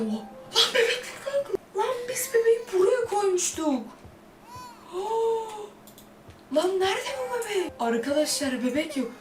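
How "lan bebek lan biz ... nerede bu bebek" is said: high, frightened squeals; scared out of your wits, voice cracking up high; terrified high squeal as a door opens by itself; panicked shriek. Real, not acted. Door opens, baby gone, panic.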